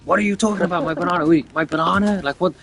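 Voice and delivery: high-pitched incredulous lad, mock bewilderment; baffled disbelief. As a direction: act out a kid totally thrown by a weird demand. Retold teen's baffled reply to aliens.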